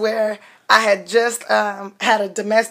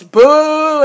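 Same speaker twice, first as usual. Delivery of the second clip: heckler's boo; 6 semitones higher, 6 dB louder than usual. Retold or imitated. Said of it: "some guy goes".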